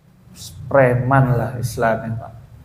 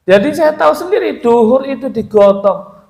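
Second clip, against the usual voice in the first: insistent; 9 semitones higher, 4 dB louder than usual. Real, not acted. Insists he witnessed it himself.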